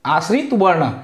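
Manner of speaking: normal talking voice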